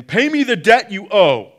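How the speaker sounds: enraged creditor, loud and high; angry demand. Acted. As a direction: bark the demand as he chokes him. Servant choking his fellow.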